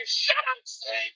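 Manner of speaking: mock Canadiens fan yell. Host mimics Canadiens fans yelling at him, high-pitched mock yell. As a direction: squeal it like an annoyed rival fan. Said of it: "then you're saying".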